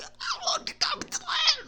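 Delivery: very high, soft, voiceless squeal; hammed-up tongue-tied squeak, slowed right down; nervous squeak. Fan imitating herself before the singer, nervous squeak. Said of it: "I was literally like that".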